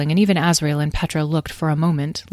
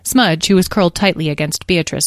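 ordinary delivery

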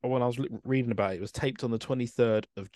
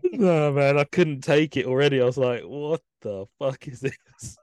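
disbelieving outburst; 5 semitones higher, 5 dB louder than usual. Retold or imitated. Retelling his shocked reaction.

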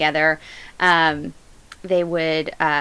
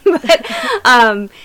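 laughing; 10 semitones higher, 9 dB louder than usual. Real, not acted. Laughing about not reading books.

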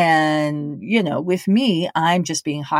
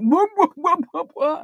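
high teasing whoops; high playful whoop whoop; mock whooping; 10 semitones higher than usual. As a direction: hoot at her like a heckler. Friend laughing and cheering.

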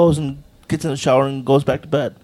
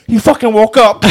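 retold panic; 4 semitones higher, 7 dB louder than usual. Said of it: "He's like".

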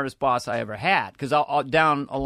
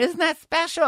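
much higher-pitched prim catchphrase; Church Lady; 14 semitones higher than usual. Acted. Comic replaying a debut sketch catchphrase, high character voice. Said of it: "the church lady".

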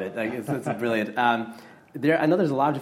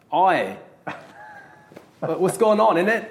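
higher, louder mock Cockney; 8 semitones higher, 5 dB louder than usual. American fan tries a playful Cockney accent. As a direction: ham up a Cockney geezer. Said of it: "the Cockney accent".

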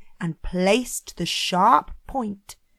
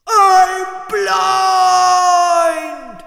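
high, loud, drawn-out howl; agonised wail; 10 semitones higher, 11 dB louder than usual. Acted. Blinded Cyclops telling his neighbour outside, agonised wail. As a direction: stretch it into a pained yell.

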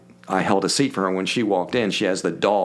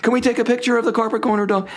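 very high, cooing fan voice; gushing woman fan. Retold or imitated. Woman fan cooing at the dog and asking for a photo, high gushing. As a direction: coo at the dog, then rush the question. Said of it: "She was like".

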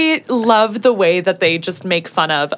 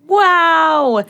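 surprised; 9 semitones higher, 3 dB louder than usual. Real, not acted. Reacting to the episode summary.

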